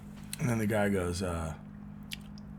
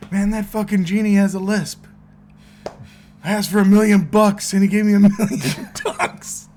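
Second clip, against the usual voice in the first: laughing punchline; 7 semitones higher, 8 dB louder than usual. Retold or imitated. Punchline quote, laughing through.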